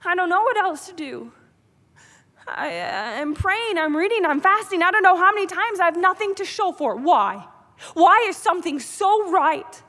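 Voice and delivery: yelling at God in desperation. Retold or imitated. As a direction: cry out to God in frustration. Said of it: "I start yelling at God".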